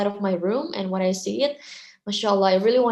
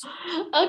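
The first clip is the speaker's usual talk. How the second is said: surprised laugh; 9 semitones higher than usual. Real, not acted. Asked her age, bursts out laughing.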